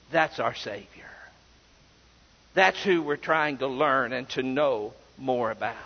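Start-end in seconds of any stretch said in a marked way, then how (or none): none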